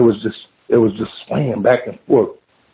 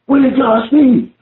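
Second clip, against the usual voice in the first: retold tearful scream, pitched way up; screaming and crying; 11 semitones higher than usual. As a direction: imitate a hysterical woman demanding answers. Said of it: "She's screaming and crying".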